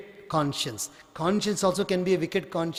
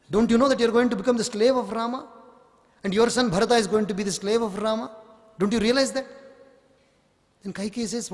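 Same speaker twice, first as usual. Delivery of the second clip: fast, higher, pushy persuading; urgent scheming maid; 3 semitones higher, faster than usual. Acted. Maid pressing the queen to act, fast and urgent. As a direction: rush the words like a schemer pressing her case. Manthara urging Kaikeyi, fast.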